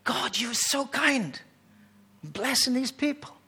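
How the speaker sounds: slow, awed thanks to God; grateful prayer. Retold or imitated. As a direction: linger over it like a moved worshipper.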